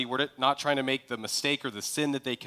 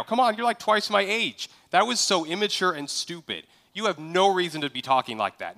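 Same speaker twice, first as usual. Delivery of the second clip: harsh rebuke; 4 semitones higher than usual. Shown as a demonstration. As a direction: chew him out like a furious boss. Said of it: "here's what a harsh rebuke might sound like".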